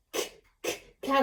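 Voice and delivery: ordinary talk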